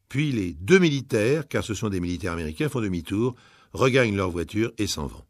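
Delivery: ordinary delivery, nothing marked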